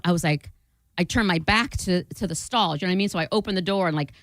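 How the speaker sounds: normal talking voice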